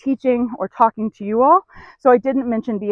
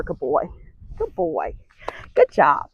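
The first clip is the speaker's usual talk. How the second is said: soft praise; 8 semitones lower, 6 dB quieter, slower than usual. Real, not acted. Praising horse softly.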